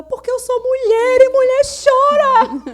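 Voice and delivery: high, loud, slow, laughing whine; mocking daughter in a high laughing wail; whiny daughter imitation. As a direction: mimic the weepy girl, hamming it up. Daughter's tearful answer imitated.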